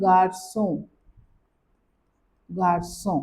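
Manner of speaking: गार्सों with nasal end; French garçon. Shown as a demonstration. Teacher models गार्सों with a nasal ending. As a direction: say गार्सों, nasal final vowel. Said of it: "इसको बोलते हैं".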